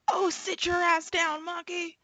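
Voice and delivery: high, slow mock cop bark; imitating the cop. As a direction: ham up the bossy cop ordering his son. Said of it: "this cop that's always".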